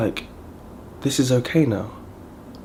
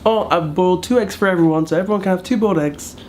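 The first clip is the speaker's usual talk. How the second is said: high-pitched fussy mother-in-law imitation; mother-in-law offering eggs, mimicked high and fast; mimicking mother-in-law; 6 semitones higher than usual. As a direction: chirp it up high like the mother-in-law announcing breakfast. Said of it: "she's like".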